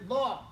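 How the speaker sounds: law with a heavy, emphatic Arabic d; teacher modelling law as a dark ḍād syllable; ḍād sound. Shown as a demonstration. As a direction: say law with the tongue heavy, vowel rounded. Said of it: "Law and dal are two different letters".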